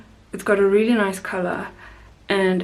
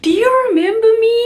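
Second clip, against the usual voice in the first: put-on much higher, louder, fast excited kid voice; eager little boy; 14 semitones higher, 7 dB louder, faster than usual. Boy imitated greeting the crew member he remembers, eager and high. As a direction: pipe up like a thrilled child. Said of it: "he waves to me and he goes".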